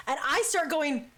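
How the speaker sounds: plain reading voice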